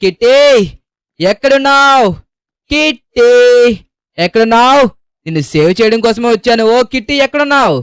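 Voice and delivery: high, loud calling out across the hills. Real, not acted. Calling the lost kitten across the mountains, high loud shout. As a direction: shout the name so the kitten can hear. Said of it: "గట్టిగా అరుచుకుంటూ వెళ్తేనే".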